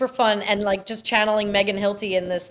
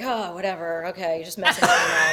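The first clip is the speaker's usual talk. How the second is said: imitating him bummed; 6 semitones lower than usual. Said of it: "he gets so bummed".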